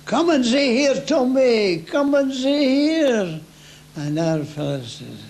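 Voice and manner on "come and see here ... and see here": high loud calling out; shouting across trenches. Retold or imitated. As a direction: call out loud across no-man's-land. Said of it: "We'd hear them say".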